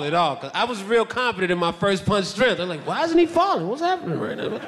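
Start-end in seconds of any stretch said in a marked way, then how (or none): 2.9-4.6 baffled. Retold or imitated. Acting own baffled thought after punch.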